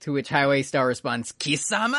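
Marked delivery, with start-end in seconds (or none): 1.3-2.0 villain shout in Japanese. Retold or imitated.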